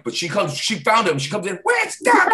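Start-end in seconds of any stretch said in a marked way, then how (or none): 1.6-2.3 much higher, louder yelled call; high mock shout. Circus girl storming the club, high shouted call. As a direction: yell for him across the room.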